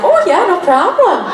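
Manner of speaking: a cocky past self, laughing; breezy retold reply. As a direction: toss it off with a laugh. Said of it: "I'm like".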